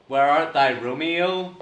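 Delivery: unengaged, barely performed Shakespeare; half-hearted acting. Shown as a demonstration. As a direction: say it like you don't really care. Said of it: "they're not really doing anything".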